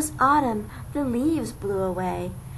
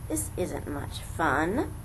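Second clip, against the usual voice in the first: low, slow, softer grumble; grumbling; 6 semitones lower, 4 dB quieter than usual. A narrator's reading. Cold wet elephant grumbling to himself, low and slow. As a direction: grumble it miserably. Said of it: "the poor elephant grumbled".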